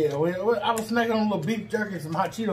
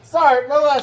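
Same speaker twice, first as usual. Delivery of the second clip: loud; 6 semitones higher, 9 dB louder than usual. Real, not acted. Loud mock apology.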